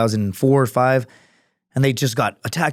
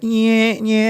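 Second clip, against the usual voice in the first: mock guard; 9 semitones higher than usual. Airport security mocked.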